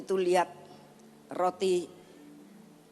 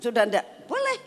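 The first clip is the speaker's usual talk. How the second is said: put-on pleading child; 3 semitones higher, 5 dB louder than usual. Grandchild begs Oma for permission, imitated childish pleading. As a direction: lift the last word into a tiny child's squeak.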